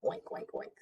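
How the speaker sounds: soft, breathy whispered oinking; whispered oinks. Acted. Pigs' sound in story.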